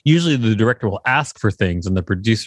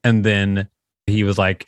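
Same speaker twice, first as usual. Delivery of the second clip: ordinary talk, nothing marked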